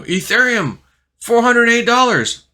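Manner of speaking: high, loud shrieked price; panicked friend. Retold or imitated. Friend reading the crash price off his phone, high loud shriek. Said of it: "He goes".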